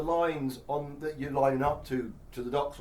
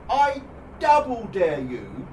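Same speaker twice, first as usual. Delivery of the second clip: loud defiant dare; 4 semitones higher, 8 dB louder, slower than usual. Real, not acted. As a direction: punch it out, slow and loud. Ranting dare, loud peak.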